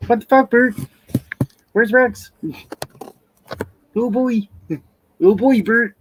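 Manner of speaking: high, excited puppet voice; squeaky Muppet buddy; Ernie voice. Acted. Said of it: "What's wrong, Bert?".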